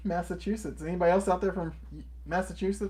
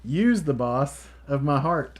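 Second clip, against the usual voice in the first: reading the card's punchline slowly and a bit louder; 4 dB louder, much slower than usual. Retold or imitated. To her husband, reading his homemade card's pun aloud, slow and fond. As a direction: savour each word of the pun. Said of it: "what does it say?".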